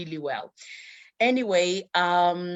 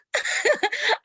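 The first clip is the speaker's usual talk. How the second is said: laughing; 5 dB louder than usual. Real, not acted. Laughing at memory.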